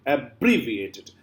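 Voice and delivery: "abbreviated" slowly, leaning on bre; stress on bre. Shown as a demonstration. Teacher explaining the capital letter, stress on bre. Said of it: "this is stress actually".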